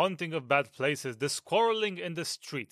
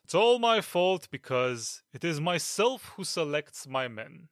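usual speaking voice

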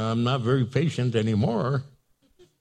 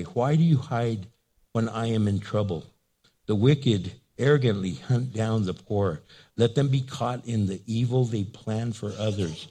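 lower, measured psalm reading; subdued, deliberate Bible recitation; scripture reading; 6 semitones lower, 4 dB quieter than usual. Acted. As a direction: read it out solemnly with long pauses. Reads Psalm aloud.

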